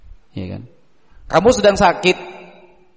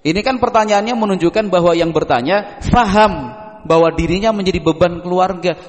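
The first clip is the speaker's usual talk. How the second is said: usual speaking voice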